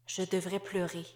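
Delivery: soft, inward, barely voiced; inner thought. Wife thinking to herself after losing her job, softly inward. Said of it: "pensa-t-elle".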